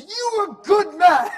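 put-on screaming, high, drawn-out Spanish accent; all-out shouted Spanish-accented praise; aggressive Spanish man. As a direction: bellow it slowly as an over-the-top imitation. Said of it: "And he's so aggressive".

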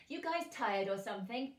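plain reading voice

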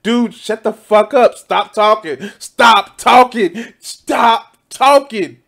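a mock Tucker Carlson snapping at his guest; rising mock exasperation, hammered out; Tucker losing patience. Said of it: "to his just like".

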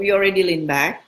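ordinary talk

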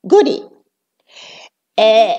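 ordinary talk, nothing marked

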